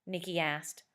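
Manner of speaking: plain reading voice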